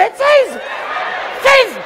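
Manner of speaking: very high falling "tchis"; high-pitched imitation of a water pistol; water-gun squirt. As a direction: squirt twice with the mouth.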